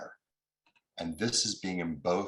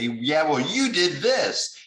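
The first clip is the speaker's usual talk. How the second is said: loud, high, indignant snap back; angry retort; 9 semitones higher, 8 dB louder, slower than usual. Retold or imitated. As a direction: fire it back like a fight. Retold quarrel, fighting back.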